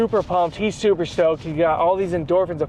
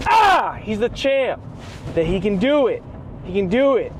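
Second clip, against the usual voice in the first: hyped up; 6 semitones higher than usual. Retold or imitated. Retelling friend's hype.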